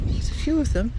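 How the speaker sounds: talking with face in a puppy's fur; muffled voice, nose buried in dog fur. Real, not acted. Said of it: "If I sound a little bit muffled".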